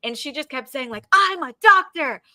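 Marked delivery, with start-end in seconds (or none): 1.1-2.2 lightly voiced insistent woman; imitating a pushy heckler, higher; mock pushy heckler. Mimicking the lady heckling from the crowd, pushy and raised.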